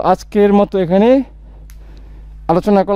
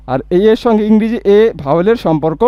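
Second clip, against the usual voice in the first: ordinary talk, nothing marked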